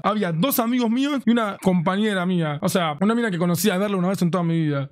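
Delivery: plain reading voice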